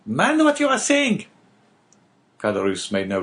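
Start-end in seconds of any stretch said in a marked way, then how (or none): 0.0-1.2 much higher, pressing woman's voice calling his name; a wife urgently hushing her husband; wife's warning. Acted. Wife warning Caderousse from the stairs, high and urgent.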